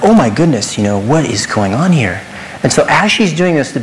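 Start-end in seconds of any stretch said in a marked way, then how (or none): 0.0-2.2 loud, emphatic retold exclamation; retold shock. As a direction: act out your own dismay, louder. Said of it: "And I'm like".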